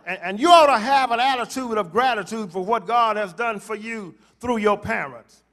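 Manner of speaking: normal talking voice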